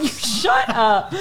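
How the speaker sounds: slow, laughing tease. Real, not acted. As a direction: laugh while telling him off. Teasing partner, laughing.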